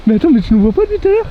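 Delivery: excited. Real, not acted. Friend startled, laughing disbelief.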